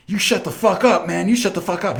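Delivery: high-pitched mock angry bully; high, agitated retold outburst; pissed-off bully. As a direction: imitate a riled school bully telling someone off. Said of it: "he's all pissed".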